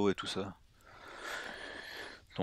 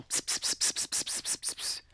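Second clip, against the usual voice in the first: whispered pss of a silencer; soft pss gun sounds; silenced gunshots; 5 dB quieter than usual. Retold or imitated. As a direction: hiss out silenced shots. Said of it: "Dernière chose que t'entends".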